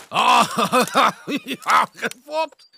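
loud, high, slow laughing tease; laughing old bear. Acted. Grandpa bear laughs at the cubs he fooled, loud. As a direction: cackle at the trick you played. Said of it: "riep de oude beer en zijn buik schudde van het lachen".

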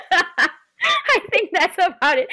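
a giggly trailing remark; giggling, slowed down; laughing. Real, not acted. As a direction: let the laugh carry the words. Laughing after answer.

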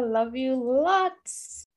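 drawn-out love you; affectionate sign-off. Real, not acted. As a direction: linger on it lovingly. Affectionate goodbye.

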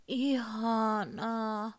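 very slow, high, sighed out; contented sigh. A narrator's reading. Pony sighs Ihanaa with pride in its new stable. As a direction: let the word melt out on a happy sigh. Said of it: "huokaisi Ihanaa".

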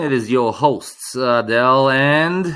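big show-opening host voice; announcer voice. Acted. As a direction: welcome them like a radio host.

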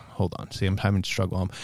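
slightly tipsy, tripping over words. Real, not acted. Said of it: "so I'm a little tipsy right now".

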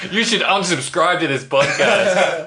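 high, loud mock outrage; mock rant. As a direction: ham up the insult.

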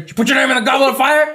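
high, rushed interrogation; hammed-up accusing question; frantic mock yell. Dumbledore rushing over to Cedric, fast mock yell.

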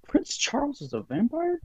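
high, surprised disbelief; incredulous. Real, not acted. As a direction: ask it in total disbelief. Incredulous question.

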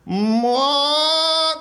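high sung shriek. A narrator's reading. Leonard shrieks in the scene, high shriek. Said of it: "You hear Leonard shriek".

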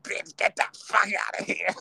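high sassy dismissal; mocking hater women.

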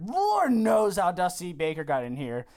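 ordinary talk, nothing marked

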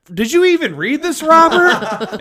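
high, sharp disbelieving scold, rising on "Robert"; scolding sister. Retold or imitated. Sister confronting her brother about his confirmation paper, incredulous.

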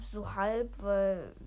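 ordinary talk, nothing marked

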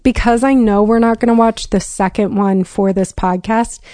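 ordinary talk, nothing marked

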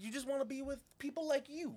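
high mocking realtor voice; a realtor being condescending, in a high mocking voice. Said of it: "she's like".

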